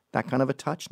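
ordinary delivery

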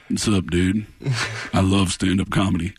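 low, laid-back dude voice; Brett Favre. Retold or imitated. Star quarterback greets a comedian, low casual impression. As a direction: drop it low and easygoing. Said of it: "And Brett goes".

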